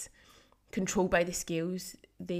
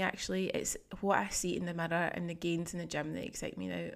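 usual speaking voice

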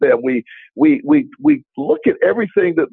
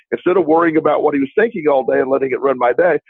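ordinary talk, nothing marked

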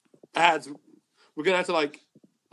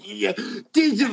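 higher, retold tipsy delight; drunken enthusiasm; 6 semitones higher than usual. Drunk friends raving about the food they found, mock tipsy enthusiasm. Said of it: "We were all really drunk".

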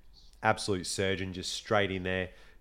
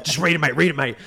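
excited urging; 5 semitones higher, 5 dB louder, faster than usual. Retold or imitated. Ben urging him to read.

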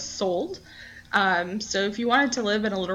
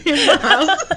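laughing mock hype; 6 semitones higher, 7 dB louder, slower than usual. Joking about ten dollars profit.

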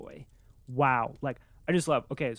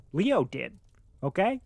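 normal talking voice

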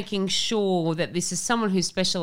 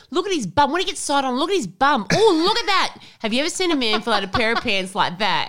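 much higher, louder, swooning mum; mum ogling; 8 semitones higher, 5 dB louder than usual. Retold or imitated. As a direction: squeal over his bum like Mum. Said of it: "She goes".

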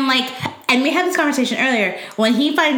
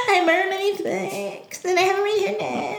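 mock guilty confession; 10 semitones higher, 3 dB quieter than usual. Acting out guilty character.

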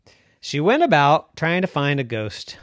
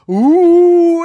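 ghost moan; 14 semitones higher, 11 dB louder than usual. A narrator's reading. Ghost moan at last minute.

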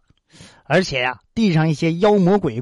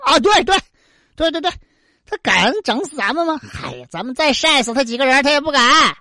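mocking chorus; 6 semitones higher than usual. Nine suns cheering their brother on, high mocking. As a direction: egg each other on, gleefully.

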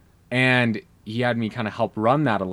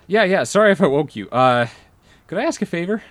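higher, louder apologetic friend; player voice; 8 semitones higher, 6 dB louder than usual. Retold or imitated. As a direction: sheepishly ask a late favor. Player voiced asking favor.

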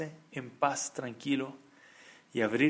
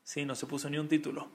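usual speaking voice

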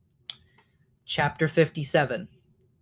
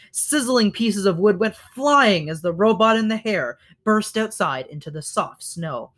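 plain reading voice

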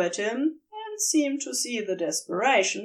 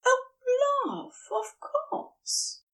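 tender reassurance; 14 semitones higher, slower than usual. A narrator's reading. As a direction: soothe him gently, like a lover giving in. Lover answering desperate plea tenderly.

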